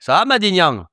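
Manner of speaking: high, hammed-up fake southern French accent; mock a southern twang with a big falling swoop; caricatured Midi accent, pitched high. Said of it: "en imitant ce qu'ils pensent être ton accent".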